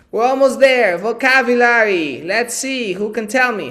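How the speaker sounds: loud, high, pumped-up teacher announcing; rally the class like a game host; excited call-out. Real, not acted. Teacher rallies viewers into the vocabulary round, loud and high.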